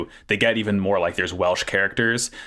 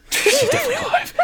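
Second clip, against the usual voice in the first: much higher, fast, laughing queen send-up; mock queen; much faster than usual. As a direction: squeak it out like a joke queen. Joining the queen joke.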